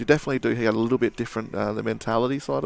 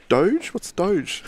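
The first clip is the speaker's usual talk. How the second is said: confused Rocky; 7 semitones higher, 3 dB louder, much slower than usual. Retold or imitated. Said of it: "He's, he's like".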